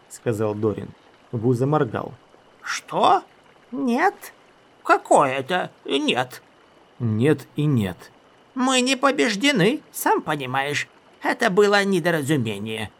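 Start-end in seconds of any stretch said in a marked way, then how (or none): 2.6-12.9 high squeaky wizard. Acted. Wu's high voice.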